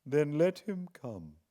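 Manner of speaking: lightly voiced curt old lady; a hint of an old woman's curt reply. Miss Havisham dismissive.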